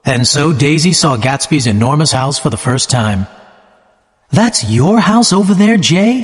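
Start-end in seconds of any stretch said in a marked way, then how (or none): 4.4-6.1 bright, eager question pitched high; cried excitedly. A narrator's reading. Daisy to Jay, seeing his mansion: excited cry. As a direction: gush at the sight of the big house. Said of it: "she cried excitedly".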